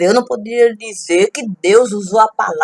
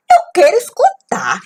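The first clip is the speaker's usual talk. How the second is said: exasperated plea; 12 semitones higher, 5 dB louder than usual. Acted. Frustrated demand to hear.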